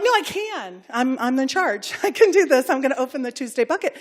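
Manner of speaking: loud, laughing defiant insistence; defiant retort. Retold or imitated. To the worried teacher, a loud defiant laughing insistence. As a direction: insist loudly you're in charge.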